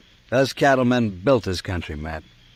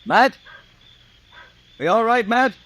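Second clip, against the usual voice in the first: calling out; 12 semitones higher, 4 dB louder than usual. Acted. Friend calls anxiously after shooting.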